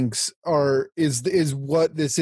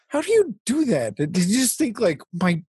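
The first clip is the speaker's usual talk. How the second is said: mock amazed hero; 7 semitones higher than usual. Quoting the hero's nosy question.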